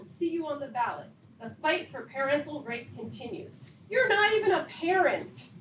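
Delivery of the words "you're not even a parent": loud, high, indignant scolding; furious parent scolding the board president; angry. Real, not acted. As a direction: let the anger rise until it breaks out. Parent confronting board president angrily.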